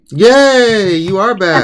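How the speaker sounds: high, loud happy cheer; excited cheer. Real, not acted. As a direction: cheer her return. Greeting returning guest excitedly.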